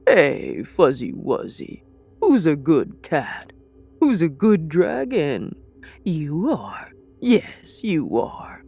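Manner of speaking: cooing, playful voice for a good kitty; pet baby talk. Acted. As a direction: coo at the beast like a house cat. Cooing to the monstrous chimera.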